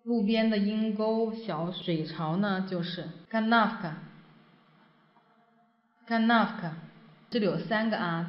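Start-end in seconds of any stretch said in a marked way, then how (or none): 3.3-6.7 канавка with the middle а stressed, slow; stress on second a. Shown as a demonstration. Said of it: "重音在这个第二个中间的啊上面".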